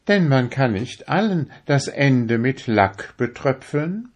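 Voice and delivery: high, proud little needle voice; a needle showing off how fine it is; vain darning needle. A narrator's reading. To the fingers, a vain needle in a high proud voice. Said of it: "fragte die Stecknadel".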